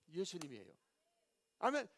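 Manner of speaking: ordinary delivery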